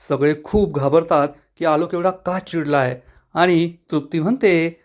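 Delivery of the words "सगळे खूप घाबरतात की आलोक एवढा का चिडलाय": usual speaking voice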